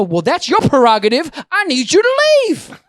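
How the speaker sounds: building to a high shout; retold exasperated outburst; indignant demand. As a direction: argue loudly, then yell the order.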